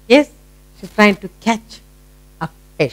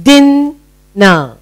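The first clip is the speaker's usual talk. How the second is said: "dinner" with a held first syllable, then a gap; split into syllables; 5 semitones higher than usual. Shown as a demonstration. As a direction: read "dinner" in two high, separate parts. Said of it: "Let's read".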